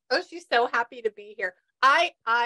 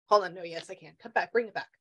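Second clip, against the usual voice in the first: ordinary talk, nothing marked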